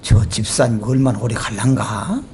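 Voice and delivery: low, doubtful musing; skeptical aside. Retold or imitated. Pastor's skeptical inner thought. (낮게 의심하며 혼잣말)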